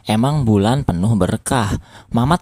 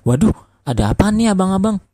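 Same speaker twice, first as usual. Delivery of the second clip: high, quick bewildered question; baffled and flustered; confused; 8 semitones higher, faster than usual. A narrator's reading. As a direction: ask in startled confusion. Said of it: "jawab Mamat bingung".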